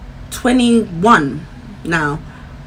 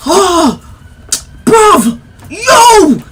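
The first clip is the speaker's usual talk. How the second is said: rising, louder, higher call of disbelief; excited boyfriend; 8 semitones higher, 5 dB louder, much slower than usual. Retold or imitated. Boyfriend on speakerphone, excited high yelling. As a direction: imitate him losing it. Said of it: "he's doing a madness".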